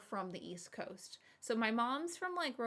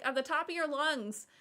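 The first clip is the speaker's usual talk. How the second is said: ordinary talk, nothing marked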